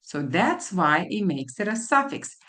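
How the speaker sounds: normal talking voice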